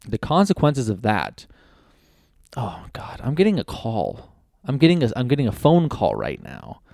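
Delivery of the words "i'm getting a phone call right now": ordinary talk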